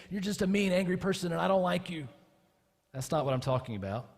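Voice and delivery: normal talking voice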